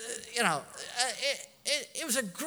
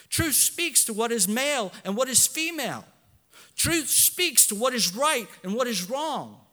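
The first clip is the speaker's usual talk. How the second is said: full-force preaching; 5 semitones higher, 5 dB louder than usual. Acted. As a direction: hammer each truth line home. Preaching climax on truth.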